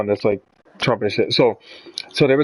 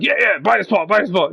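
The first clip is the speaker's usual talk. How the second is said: high-pitched mock cheering-on; imitating pro-Trump supporters; 8 semitones higher than usual. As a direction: parrot the supporters, pitched well up. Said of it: "pro-Trump supporters seeing that and it'd be like".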